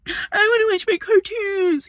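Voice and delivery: put-on high crying whine of a spoiled child; mock the kid wailing for his cartoons; whiny kid. Mocking the neighbour's kid who wanted loud cartoons, high whining cry. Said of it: "That kid probably was like".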